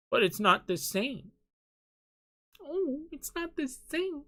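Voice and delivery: high, soft mimicking whine; hushed, high-pitched mock objection; mock whiny fan. Mimics fans objecting to his point.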